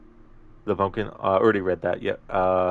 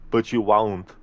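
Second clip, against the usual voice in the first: action-hero movie impression, forceful; Arnie; 5 dB louder, slower than usual. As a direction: play the menacing action hero interrogating his captive. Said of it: "an Arnie movie scene impression".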